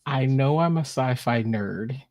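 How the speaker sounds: ordinary delivery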